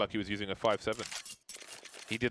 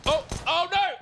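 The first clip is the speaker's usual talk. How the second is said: sudden higher, louder outcry; shocked shout; 8 dB louder than usual. Real, not acted. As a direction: gasp out the surprise. Caster reacts to sudden kill.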